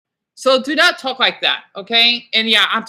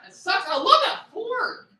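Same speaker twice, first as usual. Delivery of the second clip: upset; 10 semitones higher than usual. Real, not acted. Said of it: "I got a little bit upset".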